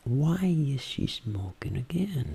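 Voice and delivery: slow whisper to oneself; intimate voice. Shown as a demonstration. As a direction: whisper slowly as if alone. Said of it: "Falando comigo mesmo". (sussurro lento, falando consigo mesmo)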